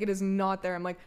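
ordinary delivery

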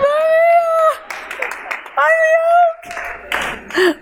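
very high, strained mock sobbing; wrecked wail. Speaker re-enacting being wrecked by friend's words, high comic wail. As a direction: imitate yourself falling apart, squeaking the words out. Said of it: "I was wrecked then".

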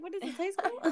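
high, sarcastic jab; scoffing. Retold or imitated. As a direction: scoff at him. Scoffing at the cheater.

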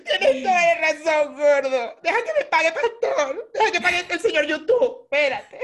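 high, loud mock-crying apology; llorando. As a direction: wail pleadingly through fake sobs and laughter. (súplica llorosa y exagerada, entre risas)

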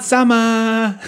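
high, emphatic call; called-out shout. Acted. Player's character hailing the old man, high call. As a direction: send the word out high. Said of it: "I shout out".

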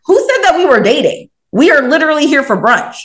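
high, punchy retold outrage; animated indignant rebuttal. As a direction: push back in disbelief, pitch lifted. Indignant rebuttal aimed at the date.

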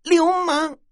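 very high, loud embarrassed shriek; shrieked scolding. A narrator's reading. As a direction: cry out a high-pitched, outraged insult. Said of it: "骂道". (又尖又高地娇声骂)

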